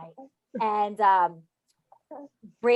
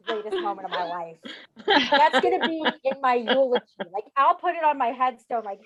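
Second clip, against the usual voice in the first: plain reading voice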